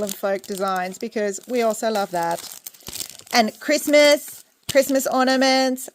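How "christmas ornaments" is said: usual speaking voice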